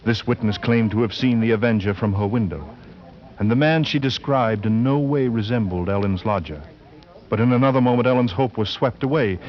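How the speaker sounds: steady, measured storyteller voice; story narration. A narrator's reading. Narrator telling of Ellen's trip to the inquest, measured narration. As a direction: carry the listener through the plot calmly.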